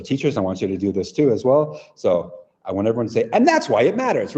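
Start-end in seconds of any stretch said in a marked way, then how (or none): 3.3-4.4 really fast. Shown as a demonstration. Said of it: "really fast".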